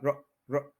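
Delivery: र as a very short "ruh"; two separate short "ruh" sounds for र; short schwa. Shown as a demonstration. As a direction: clip र to a tiny unstressed "ruh". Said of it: "फिर वही short आ जाएगा".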